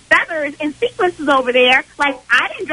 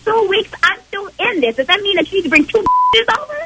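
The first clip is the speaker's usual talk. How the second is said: heated, higher-pitched protest; indignant anger; 6 semitones higher than usual. Real, not acted. Girlfriend arguing over their four-month relationship, indignant. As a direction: argue back hotly with pitch held high.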